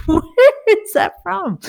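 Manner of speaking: high, laughing disbelief; mock amazement at his word, high; incredulous laugh. Teasing her husband about his word, high and incredulous.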